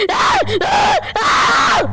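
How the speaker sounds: very high pretend screaming; high-pitched screams. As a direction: scream shrill in mock fright. Pretend play, monster attack.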